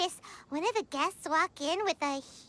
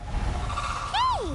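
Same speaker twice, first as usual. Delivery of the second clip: calling out; 9 semitones higher than usual. Acted. Spots someone, calls out.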